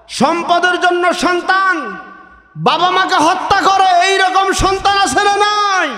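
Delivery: high, impassioned sermon delivery; fiery waz preacher calling out; preaching at full force. Acted. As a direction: preach it out to the whole gathering. Preacher rouses the crowd.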